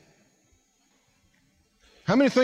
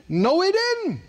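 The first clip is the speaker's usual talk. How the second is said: "No, he didn't" pitched much higher and firmer; higher insistent repeat; 9 semitones higher, slower than usual. Shown as a demonstration. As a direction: hit it again higher to insist.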